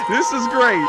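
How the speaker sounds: gleeful, still laughing; delighted. Real, not acted. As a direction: savour the moment out loud. Delighted by the replay.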